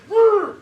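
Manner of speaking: loud high growl; growled roar. Retold or imitated. Yehuda roaring at Yosef, loud growl. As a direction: roar like an enraged man. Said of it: "He goes".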